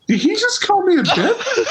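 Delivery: high, laughing disbelief; mock stunned customer; stunned guy. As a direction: squeak out the outrage. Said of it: "The guy looks at me and goes".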